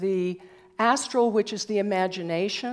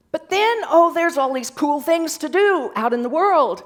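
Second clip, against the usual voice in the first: distracted excitement; 7 semitones higher, 7 dB louder than usual. Voices being lured away by distractions.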